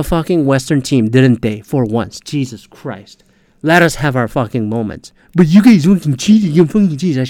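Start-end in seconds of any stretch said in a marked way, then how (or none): 5.4-7.3 mocking whine. Imitating rival fans' complaint.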